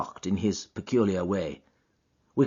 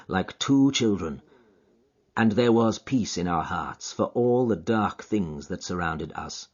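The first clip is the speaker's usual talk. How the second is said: plain reading voice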